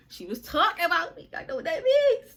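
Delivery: real laughter breaking into the words; laughing disbelief. Real, not acted. Laughing while recounting the reveal.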